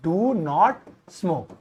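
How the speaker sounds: each word of "do not smoke" said slowly and firmly; very slow dictation. Shown as a demonstration. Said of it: "don't नहीं लिखेंगे मेरे भाई".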